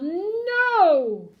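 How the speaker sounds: long, high, loud no; stubborn drawn-out shout; yelled refusal. A narrator's reading. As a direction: yell it, swelling through the word. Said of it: "The father yelled".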